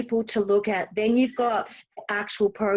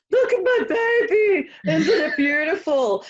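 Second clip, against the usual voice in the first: proud parent; 6 semitones higher, 4 dB louder than usual. Retold or imitated. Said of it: "it's like that baby".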